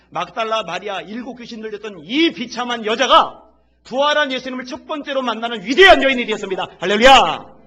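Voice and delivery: loud, rising, triumphant proclamation; preacher's soaring climax; climactic preaching. Real, not acted. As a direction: build to a shouted hallelujah. Sermon builds to a climax.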